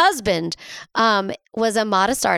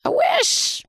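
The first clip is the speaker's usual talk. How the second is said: "I wish" squeaked far higher; high-pitched wishing. Real, not acted. Host wishing he were dead, high-pitched wail.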